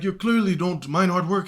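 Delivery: high, urgent helper voice; teacher asking his girlfriend about a job opening, in a high put-on voice; eager teacher on the phone.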